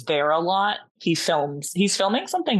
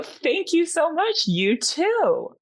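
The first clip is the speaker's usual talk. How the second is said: gushing thanks; 10 semitones higher, slower than usual. Retold or imitated. Sweet reply to celebrity.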